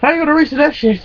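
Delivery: high-pitched, scoffing question; incredulous challenge. Retold or imitated. Mocking challenge at a stoplight.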